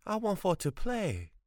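put-on high, plaintive boy's voice, stressed want; pleading boy. A narrator's reading. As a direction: beg like a lonely child.

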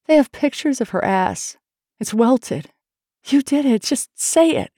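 tearful accusation. A narrator's reading. Tearful woman demands her lover confess, distressed. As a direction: build upward toward the final demand. Face wet with tears.

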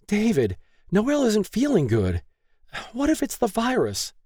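very high-pitched worried wife; anxious plea. A narrator's reading. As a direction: plead with him, voice high with worry. Worried wife pleads with angry husband.

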